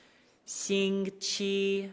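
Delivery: "星期" very slow, flat high tone; practicing tones. Shown as a demonstration. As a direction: draw out "星期" on one pitch. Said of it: "No wavering".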